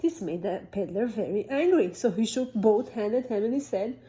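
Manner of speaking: ordinary delivery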